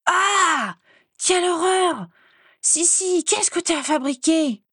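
high, wailing cry of horror; horrified. A narrator's reading. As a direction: shriek in dismay at the sight. Horrified at reflection in the mirror. (cri horrifié, très aigu)

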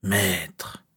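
eerie, hissing inner voice. A narrator's reading. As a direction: breathe it out as an insidious hiss. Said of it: "la voix sifflante et lancinante".

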